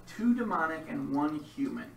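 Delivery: dramatic game master narration; story narration. A narrator's reading. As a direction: set the scene like a storyteller.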